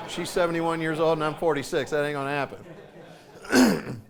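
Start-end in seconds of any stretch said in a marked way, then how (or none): 1.9-4.0 slow, drawn-out deadpan. Real, not acted. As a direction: stretch it out for the laugh. Joking about mother's lap.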